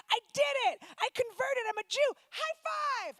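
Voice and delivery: high, bubbly, thrilled convert voice; excited friend. Retold or imitated. Imitating her excited friend, high and bubbly. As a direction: squeal it like a proud new convert. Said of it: "She's like".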